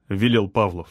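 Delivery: plain reading voice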